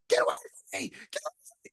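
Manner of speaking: strained mock shriek, volume held back; retold yelling. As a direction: imitate her screaming, voice squeezed high and breaking up. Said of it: "she starts yelling at him".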